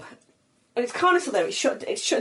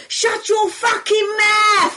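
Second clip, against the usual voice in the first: higher, louder, slow yell down from a balcony; drunken shout; 13 semitones higher, 7 dB louder, much slower than usual. Retold or imitated. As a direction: bellow it down at a friend below. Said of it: "So I shouted down to her".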